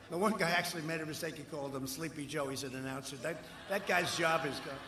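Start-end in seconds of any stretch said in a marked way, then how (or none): none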